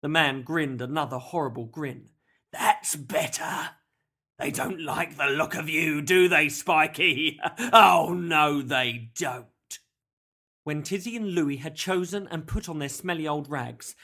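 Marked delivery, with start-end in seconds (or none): 2.5-9.5 sneering kidnapper, bursting into a loud crow; theatrical villain relishing his power; gloating villain. A narrator's reading. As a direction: taunt slowly, then boom the catchphrase. Villain's dialogue in narrated story.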